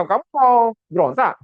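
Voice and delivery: the king turns on him; suspicious king. Pretend. Preacher playing the suspicious king accusing Nehemiah, slow and loud. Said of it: "wah, dia bisa".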